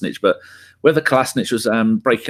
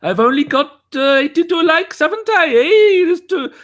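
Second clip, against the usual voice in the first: high Welsh character voice, demanding; hammed-up Welsh rant for likes; Welsh accent; 4 dB louder than usual. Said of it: "You went from Welsh to Indian to Anthony Hopkins Welsh".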